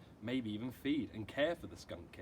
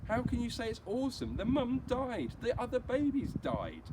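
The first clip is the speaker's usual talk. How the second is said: put-on much higher and louder, a dismayed young sister; upset girl; 13 semitones higher, 7 dB louder than usual. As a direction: protest hotly, voice high and pained. Upset Janie scolds her brother.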